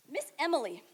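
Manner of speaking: normal talking voice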